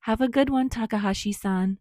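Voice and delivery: cheerful shout across the café; shouted goodbye. A narrator's reading. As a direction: call it after him across the shop. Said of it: "make sure to shout a quick".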